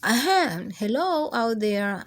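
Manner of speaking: plain reading voice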